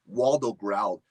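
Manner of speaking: ordinary delivery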